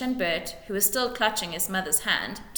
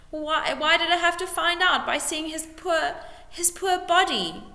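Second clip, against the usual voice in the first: high, raised, grief-struck wail; sobbing; 9 semitones higher, 4 dB louder than usual. A narrator's reading. Widow crying to the lord about her husband. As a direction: break down over his body. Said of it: "sobbed Missus Beamish".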